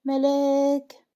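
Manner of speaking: long drawn-out, high cry of a name; screaming. A narrator's reading. Qasi as Malak is shot, screaming her name. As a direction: call out her name, stretched and loud. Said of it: "قاسي بيصرخ".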